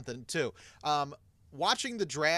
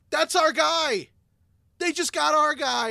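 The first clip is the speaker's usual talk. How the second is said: high, loud, drawn-out outburst; excited fan shout; 12 semitones higher, 10 dB louder than usual. Retold or imitated. Listener in the car as the pick is announced, shouting in high agitation.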